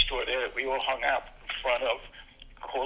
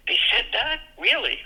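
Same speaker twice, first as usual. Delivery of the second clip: much higher, disbelieving rise; amazed kids; 11 semitones higher than usual. Retold or imitated. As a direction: gasp in disbelief like a kid. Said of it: "they would say".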